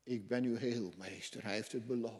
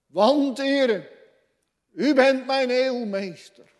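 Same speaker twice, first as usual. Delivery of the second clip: high, loud pleading cry; fervent cry to God; 13 semitones higher, 18 dB louder than usual. Acted. Preacher crying out to God, high and loud. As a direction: cry out to the Lord with all your heart.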